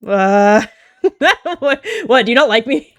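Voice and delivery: loud, high laughing protest; flustered laugh. Acted. Sister laughing loudly, flustered by the snub. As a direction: laugh through the hurt question.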